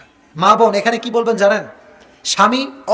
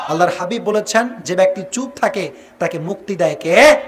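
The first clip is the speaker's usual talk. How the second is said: ordinary delivery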